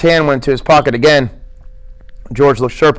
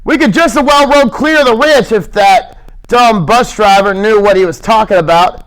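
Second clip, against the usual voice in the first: angry; 7 semitones higher than usual. Acted. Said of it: "He said angrily".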